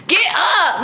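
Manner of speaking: lightly voiced fed-up great-grandmother; great-grandmother's tough love. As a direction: imitate a great-grandmother ordering someone up. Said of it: "I just hearing her voice".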